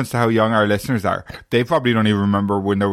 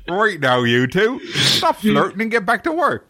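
higher, slow mock scold, laughing; mock boss; 8 semitones higher, slower than usual. Telling the two to stop flirting, a laughing mock order. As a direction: play the stern boss while laughing.

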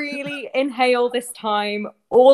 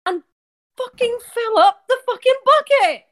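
higher, fed-up burst of swearing; mock-furious demand for the bucket; exasperated; 8 semitones higher than usual. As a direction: snap the order, pushed past patience.

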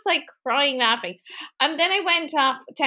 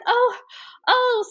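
high, mock-bewildered ohs; dazed and confused; 10 semitones higher than usual. Jessie answers Zach's pep talk, mock dazed confusion. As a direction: imitate someone dimly catching on. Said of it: "She's dazed and confused".

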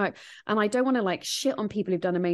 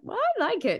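mock chirpy quote; 13 semitones higher than usual. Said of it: "you're like".

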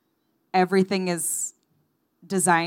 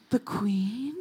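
mock gasp; 4 semitones higher, 7 dB quieter than usual.

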